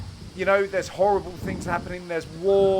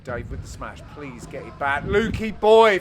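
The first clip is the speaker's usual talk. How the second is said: ordinary talk, nothing marked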